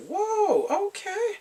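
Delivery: high, amazed whoa; surprised whoa. Real, not acted. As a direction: react as if caught off guard. Reacting to German speech.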